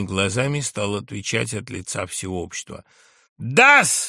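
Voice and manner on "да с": loud, high burst of accusation; thunderous shout. A narrator's reading. Drunk journalist answers the general for everyone, thunderous shout. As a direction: thunder it across the table. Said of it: "закричал он громовым голосом".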